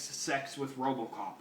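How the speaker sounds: ordinary talk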